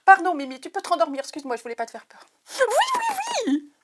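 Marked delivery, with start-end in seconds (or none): 2.6-3.7 oui criés très aigus; cri de joie. Real, not acted. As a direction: explosion de joie devant le cadeau. Discovers the gift. (crie de joie, suraiguë)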